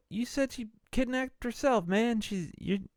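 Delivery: high-pitched, insistent retort; mock indignant protest. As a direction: act out an incredulous objection. Acted protest in retold argument.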